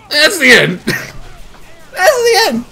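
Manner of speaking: giggling helplessly through it; laughing. Real, not acted. Cracking up at the ending.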